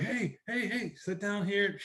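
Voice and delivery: high, drawn-out "hey" of greeting; slow, high-pitched mock hail; imitated welcoming call. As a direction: imitate townsfolk hailing an old friend. Townspeople hail the uncle on arrival.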